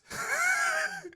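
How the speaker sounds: a squeaky laugh at the page count; high disbelieving laugh. Real, not acted. Streamer sees how many pages remain, high laugh.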